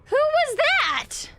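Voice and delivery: high, slow startled question. Real, not acted. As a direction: jump at a sudden noise. Startled by a strange voice.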